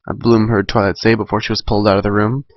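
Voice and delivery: ordinary delivery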